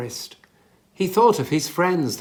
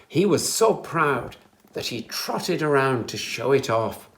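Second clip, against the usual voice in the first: plain reading voice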